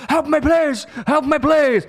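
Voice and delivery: high, loud hammed-up shout for help; mock desperate plea. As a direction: yell it like a movie hero at the ambulance. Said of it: "like, I go".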